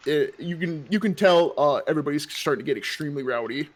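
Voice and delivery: ordinary talk, nothing marked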